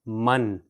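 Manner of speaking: من said as "mun" with an n ending; من pronounced "mun"; tanween sound. Shown as a demonstration. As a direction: say من clearly as "mun". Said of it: "تو اس کا تلفظ ہوگا".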